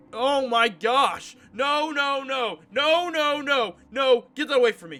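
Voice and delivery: high, loud screaming no; real frightened yelling; terrified scream. Real, not acted. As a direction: shriek in panic at the scare. Jump scare in game.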